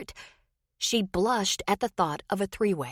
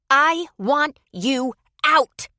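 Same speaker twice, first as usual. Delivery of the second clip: slow furious command; 8 semitones higher, 8 dB louder, much slower than usual. Acted. Wife orders him out.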